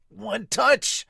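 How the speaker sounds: loud, high call on the block; sharp court call; one touch call. Acted. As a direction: shout it out to the team. Player calls block touch.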